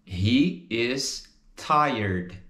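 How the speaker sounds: HE, IS, TIRED, each slow and apart; separated words, slow. Shown as a demonstration. Teacher models the full form, "He is tired" slowly separated. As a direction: say "He is tired" word by word with gaps. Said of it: "He is junta e fica He's".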